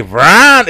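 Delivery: loud high "bran" crash; sudden comic crash noise; crash sound effect. Retold or imitated. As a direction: mimic someone crashing through the floor. Imitates the crash of a fall.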